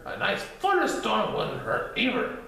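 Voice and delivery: high, playful suggestion; bouncy character. Acted. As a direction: tease with a grin in the voice. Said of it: "continued Titter".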